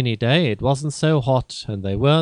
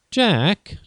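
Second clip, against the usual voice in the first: a bit annoyed; 6 semitones higher than usual. Acted. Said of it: "feeling a bit annoyed".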